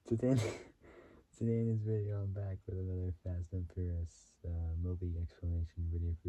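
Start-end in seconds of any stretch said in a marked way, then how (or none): 0.0-0.4 real laugh breaking into the word. Real, not acted. Vlogger losing it during his video intro, laughing. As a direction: crack up while trying to start. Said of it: "wondering why I'm laughing".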